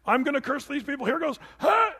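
high-pitched mock build-up to a curse; Balaam winding up. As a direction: build up to a loud, high startled "Huh". Acts Balaam attempting a curse.

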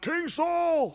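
high, drawn-out call; yelling out. Acted. David calling Saul across the ravine, high yelled call. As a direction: call out to someone far off in a high voice. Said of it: "he yells out".

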